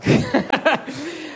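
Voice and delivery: high burst of real laughter. Real, not acted. Preacher laughing at himself after the wife's punchline, high real laugh. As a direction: laugh at your own punchline.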